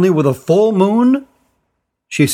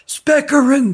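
much higher, full-throated yell; girl yelling for the magician at the top of her lungs; 11 semitones higher than usual. A narrator's reading. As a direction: yell it as high as you can. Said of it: "at the top of her lungs".

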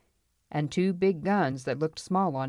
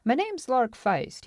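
slow, higher self-introduction; boastful gold finder; 9 semitones higher, slower than usual. Acted. Newcomer introduces himself to the saloon, boastful. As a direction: announce yourself to the whole room.

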